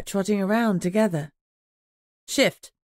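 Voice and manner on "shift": curt order. A narrator's reading. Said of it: "I ordered them curtly".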